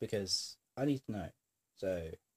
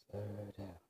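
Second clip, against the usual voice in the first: telling oneself to slow; 7 dB quieter than usual. Real, not acted. Vlogger catching own fast talking, soft self-reminder. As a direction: murmur it softly to yourself. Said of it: "Am I talking too fast?".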